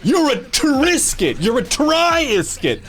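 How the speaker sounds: high and slow, hamming it; over-the-top mock announcement; high drawn-out mock. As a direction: stretch each word up high. Mock-dramatic wordplay.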